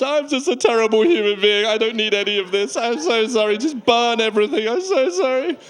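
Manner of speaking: high-pitched mock wailing apology; hammed-up self-loathing whine; pathetic. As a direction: grovel pathetically, pitch climbing. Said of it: "You feel so pathetic".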